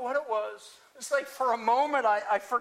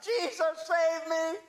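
higher-pitched plea, mildly desperate; crying out; 5 semitones higher, slower than usual. Acted. Peter sinking, cries out to Jesus for help. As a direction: cry out for rescue, pitched up. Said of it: "I cried out".

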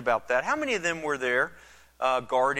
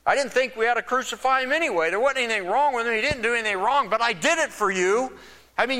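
higher, exasperated Pilate voice; higher, quicker exasperated governor; 8 semitones higher than usual. Retold or imitated. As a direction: play Pilate fed up with the priests. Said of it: "I'm thinking Pilate saying".

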